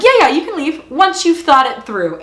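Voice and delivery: lightly voiced creepy calm, as if soothing a victim; creepy mock reassurance. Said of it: "Oh, it's so creepy".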